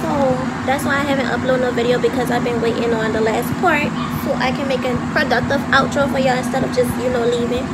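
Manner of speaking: loud, fast, so the phone hears; talking loud. Real, not acted. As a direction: raise the voice over the noise. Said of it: "but I'ma talk loud".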